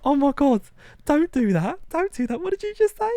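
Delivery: very high, loud mock-shocked girly voice; shrieking girl. Mouse girl shrieking at his insult, very high and loud. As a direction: shriek it like an outraged party girl. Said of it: "she was like".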